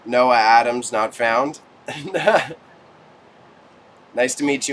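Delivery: ordinary talk, nothing marked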